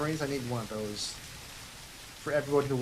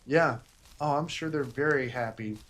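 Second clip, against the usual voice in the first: ordinary talk, nothing marked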